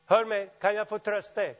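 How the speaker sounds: ordinary talk, nothing marked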